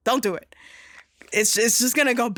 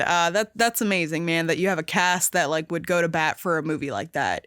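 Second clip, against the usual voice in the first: ordinary talk, nothing marked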